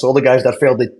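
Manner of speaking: ordinary talk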